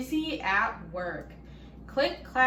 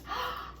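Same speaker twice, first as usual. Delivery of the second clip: sharp gasp; 13 semitones higher, 7 dB quieter than usual. A narrator's reading. Reader reacts to the note.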